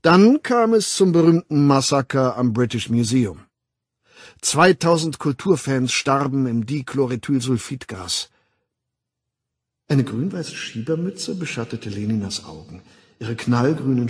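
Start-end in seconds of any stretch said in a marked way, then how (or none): 0.0-8.3 emphatic public lecturer; lecturing World Controller. Acted. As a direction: hold forth with authority, punching key words. Weltaufsichtsrat doziert. (dozierend, nachdrücklich)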